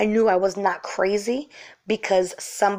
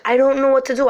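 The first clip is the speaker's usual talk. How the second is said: higher, louder mock-helpless quote; imitated helplessness; 5 semitones higher, 5 dB louder, faster than usual. Super at a loss over the door, mimicked helplessness.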